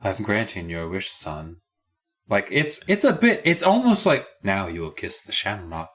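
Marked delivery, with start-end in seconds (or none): 0.0-1.6 low, soft, ominous father; creepy dad. Acted. Dad granting his son's birthday wish, creepy and low. 4.4-5.9 low, soft, ominous command; a touch of hushed menace; creepy. Acted. Mocking the dad's wish-granting, low and creepy.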